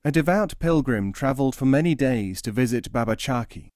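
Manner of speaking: ordinary delivery, nothing marked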